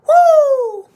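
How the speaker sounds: loud, very high whoop; Hamza Chimaev. Acted. Imitating Hamza after a fight, high loud whoop. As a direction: let out a piercing victory whoop. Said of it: "That's my Hamza".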